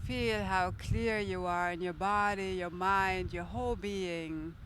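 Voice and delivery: calm, steady guiding voice; guided meditation. Acted. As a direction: guide the breathing gently. Leads a guided breath meditation.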